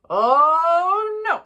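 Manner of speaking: long, loud, rising groaned "oh", then a drop; hammed-up fatherly dismay; exasperated dismay. A narrator's reading. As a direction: draw out the horror like an appalled dad.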